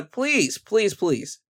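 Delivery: ordinary talk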